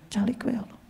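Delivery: unvoiced hush, barely a breath; whisper. Preacher whispers to the audience that Allah stays silent, hushed. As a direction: breathe it out as if keeping a secret.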